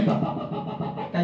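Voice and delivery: soft unvoiced pa pa pa ticking sound; imitated phone tapping. Preacher imitates someone busily tapping a phone, soft unvoiced pa-pa. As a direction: tap out quick breathy pa syllables like busy thumbs.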